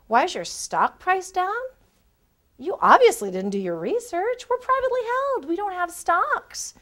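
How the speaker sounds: high, incredulous retelling; mock the clueless question, then set it right; mock indignation. Coach retells the cocky candidate's question, mock incredulous. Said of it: "here he was saying".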